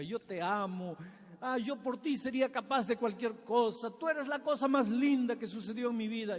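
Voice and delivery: lovestruck suitor. Acted. Young man wooing a girl, gushing lovesick tone. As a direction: sweet-talk her like a smitten young man.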